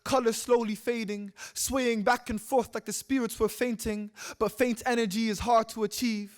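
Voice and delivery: measured, lifted poetry recitation; poem read out with steady cadence; spoken-word poem. Acted. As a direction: recite it as verse, pitched a little above talk. Recites a poem before talk.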